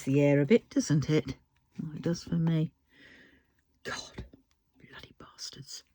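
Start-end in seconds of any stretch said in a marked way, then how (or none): 3.8-5.8 a breathy whispered oath under the breath; muttered swearing meant to be half-hidden; whispered curse. Real, not acted. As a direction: hiss it out under your breath. Muttered curse after rant.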